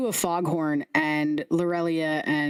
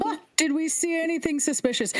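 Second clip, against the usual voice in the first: high, quick head voice; 9 semitones higher, faster than usual. Acted. Head repeating the question.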